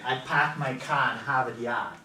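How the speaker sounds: Boston accent with no R: "park" as "pahk", "car" as "cah", "Harvard Yard" as "Hahvahd Yahd". Shown as a demonstration. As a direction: drop every R, New England style. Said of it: "they would say".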